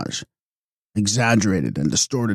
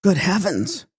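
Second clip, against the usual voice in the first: surprised; 9 semitones higher than usual. A narrator's reading. Elil cries out in surprise.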